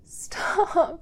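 ordinary talk

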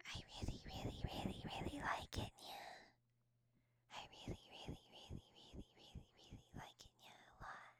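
soft unvoiced whisper. Real, not acted. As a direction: breathe it with no voice. Repeats the line in a whisper.

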